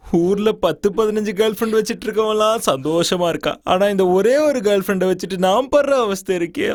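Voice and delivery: high, whiny self-pitying moan, slowing at the end; comic wailing lament.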